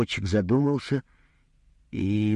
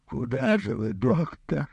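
high, whining near-sob; patient pleading on the verge of tears; nearly crying; 10 semitones higher than usual. A narrator's reading. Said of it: "почти заплакал Булыжников". (чуть не плача)

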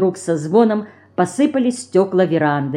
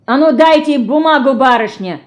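loud, higher demanding shout; hoarse shout; 7 semitones higher, 6 dB louder than usual. Acted. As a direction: bark at her to hand it over. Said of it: "хрипло крикнул он". (хриплый требовательный крик)

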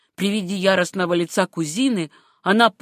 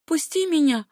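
high urgent plea at normal volume; tense, raised-pitch demand; near shout; 8 semitones higher than usual. A narrator's reading. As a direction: demand it, just short of shouting. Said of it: "почти крикнула Сюзанна".

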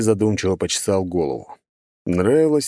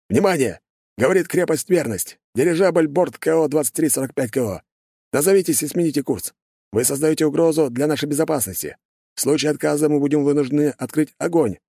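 raised, formal radio announcement; stern, official warning over radio; 8 semitones higher than usual. Acted.

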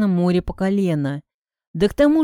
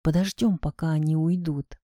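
soft, low murmur; quiet and low; hushed, near whisper; 5 semitones lower, 5 dB quieter than usual. A narrator's reading. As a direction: keep your voice down while hiding. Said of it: "сказал я шёпотом".

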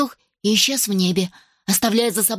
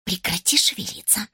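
hushed whisper; 4 dB quieter than usual. Acted. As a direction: whisper it urgently. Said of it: "зашептала Симонна".

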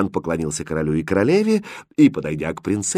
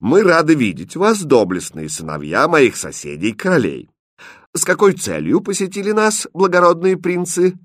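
stately, raised royal address; majestic; 9 semitones higher, 3 dB louder than usual. Acted. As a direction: welcome them grandly, like a king. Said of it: "величаво произнёс". (величаво, по-королевски)

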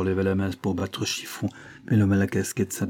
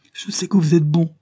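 high, loud, impassioned protest; insistent plea; 8 semitones higher, 8 dB louder than usual. Acted. Chiffon refusing to get up, passionately insisting. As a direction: push back urgently, pleading. Child insists while struggling.

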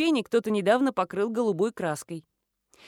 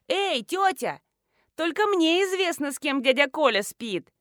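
put-on high, girlish chirp; chirping teenager; 6 semitones higher, 3 dB louder than usual. A narrator's reading. Schoolgirl calls after the departing woman, chirping. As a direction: chirp it out to lure her back. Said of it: "прочирикала представительница поколения соцсетей".

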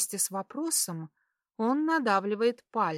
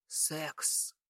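serious; 5 semitones lower, 11 dB quieter than usual. A narrator's reading. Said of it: "серьёзно произносит он".

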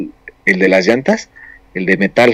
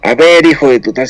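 higher and louder mock yell at the demon; a retold angry outburst, half-acted; fed-up father shouting at the demon; 5 semitones higher, 5 dB louder than usual. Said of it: "le empezó a gritar al demonio".

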